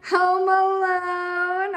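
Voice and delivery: slow, high, stretched-out title; drawn-out mock announcing. Real, not acted. Mom joking about the movie, drawn out and high.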